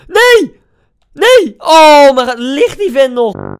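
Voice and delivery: high, loud repeated no; desperate shouting. Real, not acted. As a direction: scream at the missed chance. Shot goes wide in FIFA. (wanhopig geschreeuw)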